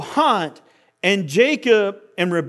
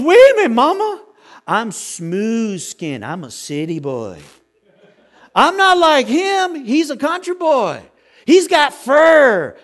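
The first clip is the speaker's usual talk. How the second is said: higher and louder, pleading with mama; whiny protesting son; 5 semitones higher, 5 dB louder than usual. Retold or imitated. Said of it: "he goes".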